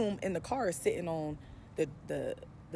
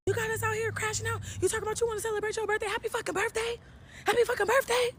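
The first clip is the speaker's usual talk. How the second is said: much higher mocking nag; mocking cousins; 4 dB louder than usual. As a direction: jeer at her birthday in a high voice. Cousins mocking her in car.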